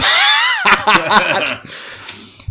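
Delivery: high, loud burst of laughter; a big shrieking cackle; wife's loud shriek of laughter. Retold or imitated. The wife walking in on the mess: loud, high shriek of laughter. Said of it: "Claire opened the door and went".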